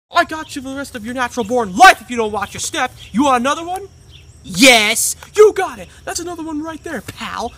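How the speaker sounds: shouting principal; furious principal yelling. Retold or imitated. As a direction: yell it in a rage. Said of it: "funny scenes".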